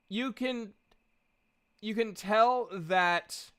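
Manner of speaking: ordinary talk, nothing marked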